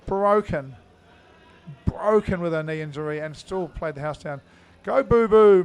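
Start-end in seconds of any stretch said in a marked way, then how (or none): 4.8-5.6 loud cheer. Real, not acted. Cheering his adopted brother.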